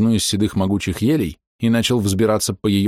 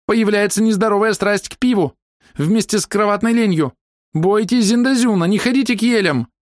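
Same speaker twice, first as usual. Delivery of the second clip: high-pitched ominous warning; high, declaimed female threat; high-voiced woman; 13 semitones higher than usual. A narrator's reading. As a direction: warn them off in high voice. Hidden woman continues her warning.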